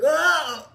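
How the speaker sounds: high, loud mock "oh"; imitated pained cry. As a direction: cry out as the man in the story. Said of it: "like it's going in".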